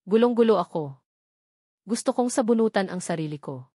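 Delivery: usual speaking voice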